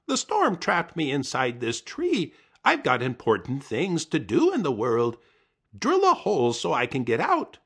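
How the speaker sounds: high, loud insistent voice; pushy high trickster voice; demanding Coyote. Acted. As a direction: boss the bird into drilling.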